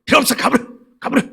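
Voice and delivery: high, strained temper-fit yell; imitated angry screaming. Friend driving the helper away, mock screaming. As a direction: act out a friend's screeching dismissal. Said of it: "악을 쓰고 성질을 낸다".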